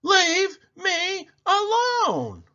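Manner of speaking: put-on old woman shouting back at her family as she leaves, high and drawn out; shouted. A narrator's reading. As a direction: draw it out long and high like a fed-up old woman. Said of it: "the old woman shouted".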